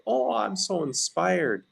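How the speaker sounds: high, breathy-free mock swoon over being inspired; mock gushing. Speaker mocking shallow inspiration, mock gushing. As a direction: gush it like a passing feeling.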